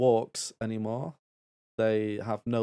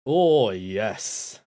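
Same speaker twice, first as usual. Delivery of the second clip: usual speaking voice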